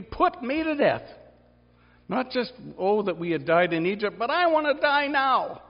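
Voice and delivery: high-pitched, pleading lament to God; anguished Moses. Acted. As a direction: cry out to God like a man at the end of his rope.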